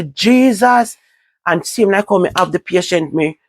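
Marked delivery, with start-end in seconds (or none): 0.0-1.0 high wailing cry of the name; crying out to Jesus. Retold or imitated. At work on hearing the news, a high wail. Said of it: "I'm start bawl".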